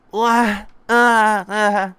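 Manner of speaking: loud, high bawling in bursts; acted wailing sobs; crying wail. A narrator's reading. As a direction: bawl openly like a child. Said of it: "Senpai just cries".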